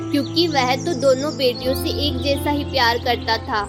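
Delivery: usual speaking voice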